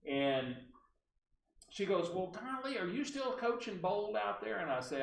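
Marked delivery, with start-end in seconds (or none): 2.1-4.6 high-pitched imitation of the caller's accent; Barbara Delano. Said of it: "I have to use her accent".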